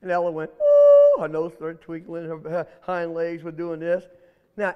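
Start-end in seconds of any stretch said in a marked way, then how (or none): 0.5-1.2 high loud scared squeal; frightened ooh. Acted. Rabbit hearing she'll be eaten, scared squeal.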